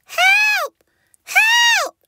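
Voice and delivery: high, loud cries of a small dinosaur; calling for help. A narrator's reading. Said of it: "calling for help".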